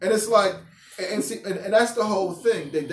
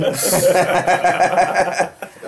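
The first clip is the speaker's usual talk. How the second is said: loud laugh; 5 dB louder than usual. Real, not acted. Teacher cracking up at his own Assassin's Creed slip, laughing. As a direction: burst of real laughter.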